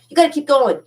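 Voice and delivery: rally your viewers to keep going, loud and high; emphatic push. Real, not acted. Urging streaming viewers not to give up, loud and emphatic. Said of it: "So you gotta keep going".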